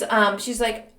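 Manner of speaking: ordinary delivery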